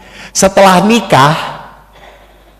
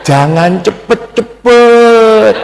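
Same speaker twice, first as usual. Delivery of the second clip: very slow, drawn-out, higher gentle lilt; refined Solonese politeness; 3 semitones higher, much slower than usual. Acted. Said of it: "ngomongnya halus-halus". (menirukan orang Solo yang halus, mendayu-dayu)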